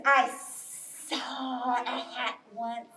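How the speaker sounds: very slow, sibilant snake voice; hissing snake. Acted. Snake answering bear about a hat, slow and slithery. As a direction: stretch the s sounds into long hisses. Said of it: "And the snake says".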